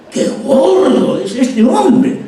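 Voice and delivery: hammed-up amazed shout; exaggerated exclamation. Shown as a demonstration. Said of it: "una cosa es que yo diga gordo".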